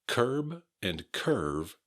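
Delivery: curb with a final B, curve with a final V; B versus V. Shown as a demonstration. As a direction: contrast the endings of curb and curve slowly. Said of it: "V sound becomes very important".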